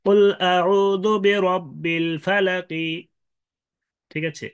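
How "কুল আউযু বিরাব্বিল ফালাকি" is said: slow chant ending "ফালাকি" with a light i; rum stop recitation. Shown as a demonstration. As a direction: recite slowly, voicing the last kasra of "ফালাকি". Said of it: "এই রুম করে ওয়াকফ". (রুম করে ধীর তিলাওয়াত, শেষে "ফালাকি")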